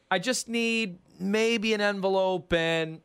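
slow, drawn-out request climbing high; coy shakedown. Acted. Racketeer asks the newcomer for weekly money, slow high coy demand. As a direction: stretch the demand out, high and mock-casual. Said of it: "And then he goes".

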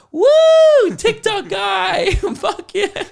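high, loud whooping yell from the crowd; drunk crowd cheer. Retold or imitated. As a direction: shout it like a rowdy party guest. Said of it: "all these guys like".

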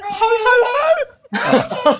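high, loud Santa-style ho-ho-ho; big gleeful child's shout, copied; imitating young boy. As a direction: mimic a child bellowing Santa's laugh at a stranger. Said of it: "Tommy went up to him and he went".

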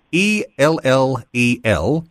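slow, separate letters; measured spelling of a web address. Real, not acted. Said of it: "Just note the spelling there".

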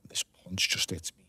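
hushed, breathy storyteller's whisper; dramatic whisper. Acted. Boxer whispering the moment the punch hits. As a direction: drop right down to a whisper as the punch lands. Retelling being hit.

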